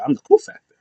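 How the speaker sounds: lightly voiced high cocky boast; a hint of a cocky wrestler; Carlito boast. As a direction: toss it off as the cool guy.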